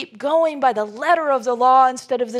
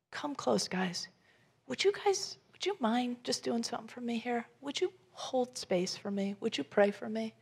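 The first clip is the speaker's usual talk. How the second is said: pleading softly; 9 dB quieter than usual. Retold or imitated. Jesus asks friends for prayer.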